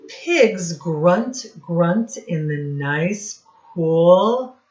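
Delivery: lively read-aloud, sound words popped out; reading to children. Acted. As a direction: read it to a class of little kids, playing up the noises. Teacher reads rhyming picture book.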